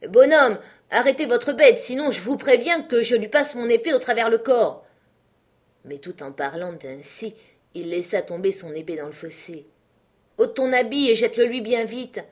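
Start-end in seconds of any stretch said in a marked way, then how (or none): 0.1-4.8 loud, higher call across the field; shouting. Acted. Benjamin yelling at the farmer to stop his bull, loud shout. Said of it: "il appelait le paysan et s'écriait". 10.4-12.2 louder and higher, hurried warning; urgent cry. Acted. Sergeant warning Benjamin to drop his red coat, urgent cry. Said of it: "s'écria Mâchecourt".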